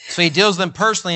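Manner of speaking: ordinary talk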